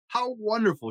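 high-pitched exclamation of delight; the king cries out in joy; delighted cry. Acted. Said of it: "he cried".

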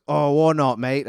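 high, loud hammed-up British accent; a hint of a cheeky British bloke. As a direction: play Reginald the robot in a mock British accent. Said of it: "pretend he's still talking in a British accent".

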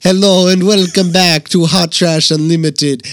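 loud French sliding into Italian; failed French accent. Acted. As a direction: aim for French, drift Italian. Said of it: "It's going Italian".

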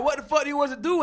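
high, loud retold protest; imitated shout of confusion; exasperated shout. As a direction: yell it like a fed-up player, acted out. Retells players' exasperation at coach.

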